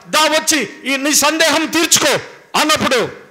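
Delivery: fervent pulpit delivery; full-force preaching. Acted. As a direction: preach it out to the congregation. Preaching Jesus' call and reply.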